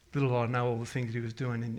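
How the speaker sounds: ordinary talk, nothing marked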